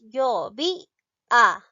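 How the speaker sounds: "yo vi a" broken into separate, drawn-out syllables. Shown as a demonstration. Teacher asking which syllable sounds strongest, sounding out "yo vi a". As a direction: sound out "yo vi a" with clear gaps between syllables. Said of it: "A ver, pronunciamos".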